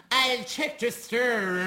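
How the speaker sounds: slow, over-enunciated fake posh Northern Irish; put-on posh accent. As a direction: stretch each word in a put-on posh accent. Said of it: "a nicer accent, that they had to enunciate".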